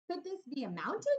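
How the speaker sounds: a small toy bear marveling to himself; wondering bear. Acted. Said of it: "he wondered".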